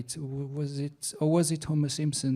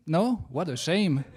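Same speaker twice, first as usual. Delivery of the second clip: ordinary delivery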